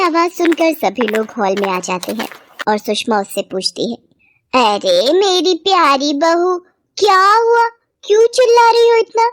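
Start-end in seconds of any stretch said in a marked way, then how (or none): 4.5-9.3 coaxing mother-in-law. Acted. Sushma asks beloved bahu what happened, high coaxing alarm. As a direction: stretch the endearment, then pitch the question high.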